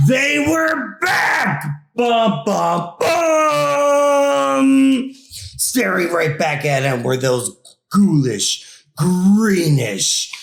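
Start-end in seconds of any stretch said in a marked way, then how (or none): 0.1-5.1 big, high shout with a rising bum bum bum sting; dramatic reveal. A narrator's reading. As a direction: belt out the spooky reveal like a horror-movie chord.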